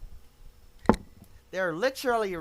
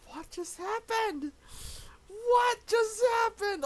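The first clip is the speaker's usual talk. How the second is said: disbelieving cry; 13 semitones higher than usual. Real, not acted. Commentator cannot believe the result, disbelieving cry. Repeats the question in live shock.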